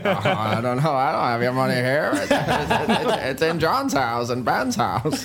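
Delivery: lightly voiced, halting old-film drawl through laughter; a hint of Jimmy Stewart, giggling; Jimmy Stewart impression. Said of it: "I can do Jimmy Stewart".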